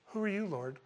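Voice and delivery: soft, mild little question; mild casual asking. Acted. Preacher showing how Paul didn't ask it, soft and unbothered. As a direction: ask it lightly and quietly, as if nothing happened. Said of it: "I don't think Paul went".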